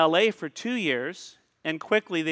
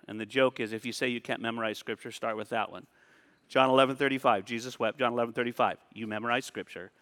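ordinary talk

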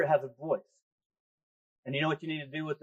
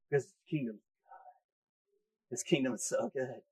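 whisper; 5 semitones lower, 8 dB quieter than usual. Real, not acted. Preacher hushing the congregation on God's goodness, whispering. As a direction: breathe the words in a hush.

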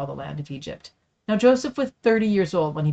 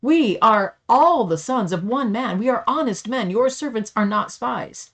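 higher, louder pleading protest; exclaiming; 6 semitones higher, 5 dB louder than usual. A narrator's reading. Brothers protesting to the governor, louder, higher exclaiming. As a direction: urgently deny the charge, voice raised. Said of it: "But they exclaimed".

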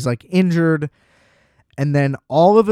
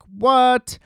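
shocked; 9 semitones higher than usual. Retold or imitated. Actor hears shocking accusation.

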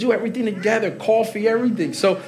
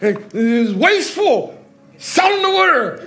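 higher and louder mock outrage; mock mad; 7 semitones higher, 5 dB louder than usual. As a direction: ham up the offended complaint. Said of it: "he was mad".

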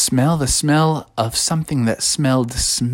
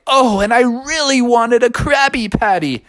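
high, whiny mermaid lament; disappointed Ariel; 10 semitones higher than usual. Acted. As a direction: moan it pitched right up. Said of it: "And Ariel was like".

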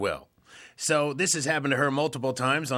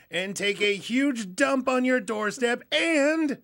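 put-on old lady voice; 5 semitones higher than usual. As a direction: read it like a prim old lady. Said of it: "Use your old lady voice".

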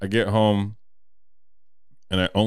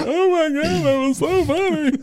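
laughing high-pitched; 4 dB louder than usual. Retold or imitated. Him laughing after the fall.

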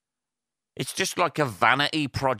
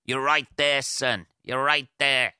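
higher-pitched Northern Irish film quote; Northern Irish accent; 5 semitones higher, 3 dB louder than usual. Acted. Quoting a film character to listeners, Northern Irish accent. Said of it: "in a fucking Northern Irish accent".

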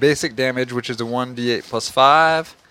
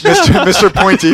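quoting Buffy; 6 semitones higher, 7 dB louder than usual. Retold or imitated. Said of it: "as Buffy would say".

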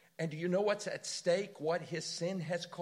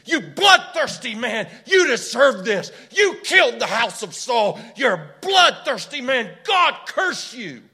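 furious, much louder, higher shout of curses; cursing shout; 8 semitones higher, 16 dB louder than usual. Retold or imitated. Shimei cursing David as he throws rocks, furious shout. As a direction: hurl curses at him at the top of your voice. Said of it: "He's throwing rocks and cursing him".

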